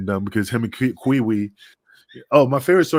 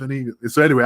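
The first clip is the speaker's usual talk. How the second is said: ordinary talk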